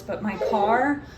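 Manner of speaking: ordinary talk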